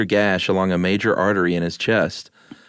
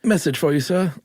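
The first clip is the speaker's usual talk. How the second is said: higher, put-on messenger announcing; comic messenger voice; 7 semitones higher than usual. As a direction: deliver it like a stiff herald in a comedy sketch. Joke quote after arrow impression.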